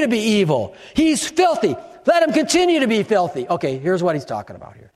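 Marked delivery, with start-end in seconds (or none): 0.9-3.5 forceful reading. Acted. Quoting the verse in heat.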